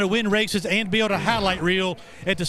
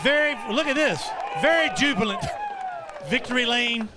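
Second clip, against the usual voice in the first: excited; 6 semitones higher than usual. Real, not acted. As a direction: shout it with delight. Excited reporter in victory lane.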